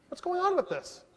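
high-pitched, incredulous challenge; retold indignant demand. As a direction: act out a suspicious guard demanding an explanation. Retold guard demanding an explanation.

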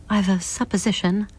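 ordinary delivery

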